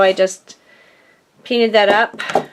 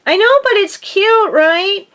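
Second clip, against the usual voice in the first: playful; 10 semitones higher, 3 dB louder than usual. Real, not acted. Playful defence of pink bin.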